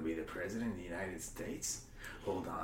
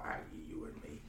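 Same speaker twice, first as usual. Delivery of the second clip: softer, breathy whisper; whispered aside; 4 semitones lower, 7 dB quieter, faster than usual. Acted. Telling Evie the votes, a hushed whispered aside. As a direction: slip the words in as a whisper.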